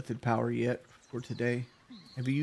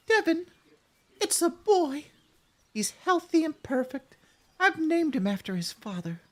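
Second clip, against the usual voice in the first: put-on much higher, sweet young wife reading her letter aloud; damsel voice; 8 semitones higher than usual. Reading the wife's letter to soldier Devin, high damsel voice. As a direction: play the doting damsel writing to her man, easing down toward normal by the end. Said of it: "And you play good damsel".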